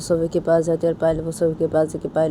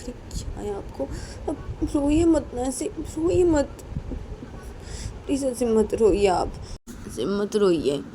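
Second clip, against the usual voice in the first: high, tender coaxing; consoling; 6 semitones higher, 4 dB quieter than usual. Acted. Payal begs Poonam not to cry, consoling her. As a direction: gently plead with her to stop crying.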